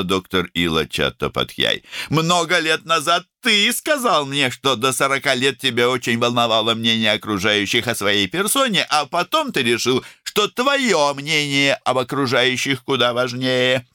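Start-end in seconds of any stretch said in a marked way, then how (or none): 2.1-13.9 high, emphatic, opinionated character voice; outspoken woman doctor. A narrator's reading. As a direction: hand down brisk opinions in a high voice.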